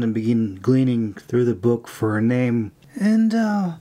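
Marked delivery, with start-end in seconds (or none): 3.0-3.7 curious. A narrator's reading. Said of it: "she pried curiously".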